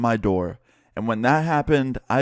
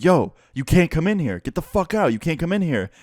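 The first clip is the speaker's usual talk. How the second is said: high-pitched urgent protest, acted out; alarmed angry friend; 7 semitones higher, 3 dB louder than usual. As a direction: order an intruder out, mimicking a friend. Friend ordering intruder out, acted out.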